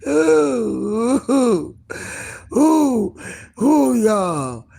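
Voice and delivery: high, loud ooh through laughter; cracking up, can barely speak; laughing fit. Real, not acted. Overcome by laughter.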